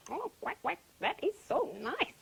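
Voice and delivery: pleased duck voice. A narrator's reading. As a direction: quack with pleasure at a tasty find. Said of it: "said the duck".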